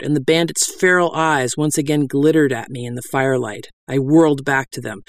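ordinary delivery

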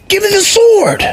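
put-on a little boy demanding the sword; screaming child god; child shout. A narrator's reading. Vali shouts lunging at wolf.

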